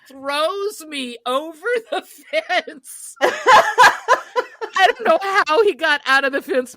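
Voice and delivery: slow, breaking into laughter; laughing. Real, not acted. Teller recalling her father's rescue, laughing hard. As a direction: laugh through the rescue part.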